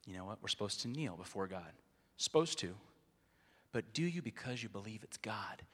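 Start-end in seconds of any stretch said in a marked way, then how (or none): none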